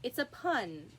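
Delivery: ordinary delivery, nothing marked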